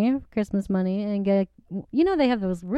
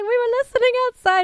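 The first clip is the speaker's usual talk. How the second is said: put-on fast, high and louder girlish gush; excited girls; 6 dB louder, faster than usual. Girls confessing they eavesdropped, fast high mock gush. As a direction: rush it out like an eager fan. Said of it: "they're like".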